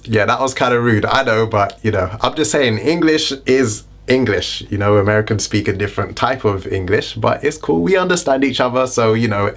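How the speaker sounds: usual speaking voice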